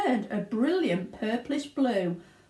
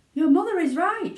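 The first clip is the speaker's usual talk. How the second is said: higher, loud father's shout; 10 semitones higher, 7 dB louder than usual. Acted. Father shouts at Violet, alarmed. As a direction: bark agreement in alarm. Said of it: "shouted Mister Beauregard".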